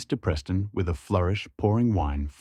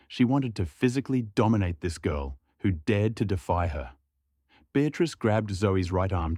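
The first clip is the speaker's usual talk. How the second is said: plain reading voice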